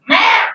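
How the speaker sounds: loud, high mewing bird call; bird cry imitation. Teacher imitating the bird yelling at her, loud yowl. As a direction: yowl it like a cat-voiced bird. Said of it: "this bird ... was like".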